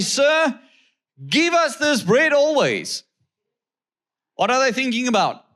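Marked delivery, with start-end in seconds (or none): 0.0-3.0 pleading crowd. Retold or imitated. Crowd asks Jesus for bread.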